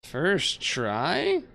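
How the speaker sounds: can't believe it worked; surprised. Real, not acted. Gamer reacting to instant connection, surprised.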